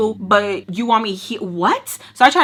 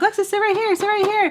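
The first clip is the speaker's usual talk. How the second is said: high-pitched little munchkin voice; piping kid impression; munchkin's demand; 12 semitones higher, faster than usual. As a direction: mimic an insistent little one, pitched way up.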